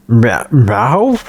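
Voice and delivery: questioning meows. Acted.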